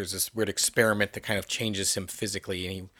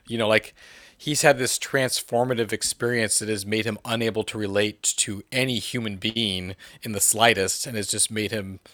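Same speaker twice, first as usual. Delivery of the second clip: ordinary delivery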